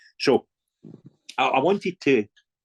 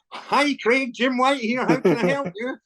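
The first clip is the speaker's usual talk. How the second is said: big, high-pitched caller greeting; 13 semitones higher, slower than usual. Retold or imitated. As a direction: put on a high, hearty phone introduction. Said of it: "That was a great impression as well".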